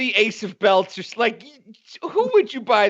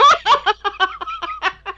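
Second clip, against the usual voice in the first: high-pitched cackling laugh; shrieking laughter; helpless laughter; 14 semitones higher than usual. Real, not acted. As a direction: crack up completely. Cracking up at the catchphrase.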